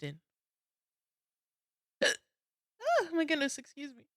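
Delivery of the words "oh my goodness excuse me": fighting off hiccups; talking through the hiccups; hiccupy. Real, not acted. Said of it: "God, I'm so hiccupy right now".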